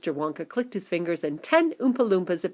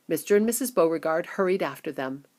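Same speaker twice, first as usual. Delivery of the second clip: usual speaking voice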